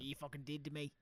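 very soft Australian accent. Shown as a demonstration. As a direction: try a hushed Australian twang. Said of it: "I do an Australian accent".

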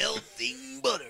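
high, slow wrestler impression; Macho Man. Host echoes his co-host's Macho Man line, wrestler impression. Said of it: "Macho Man says".

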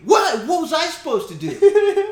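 very high, loud whiny protest; defensive cop voice. Pretend.

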